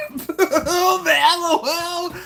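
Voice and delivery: loud, high laughing words; real high-pitched cracking up. Real, not acted. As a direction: talk through a big laugh. Laughing hard at a chat message.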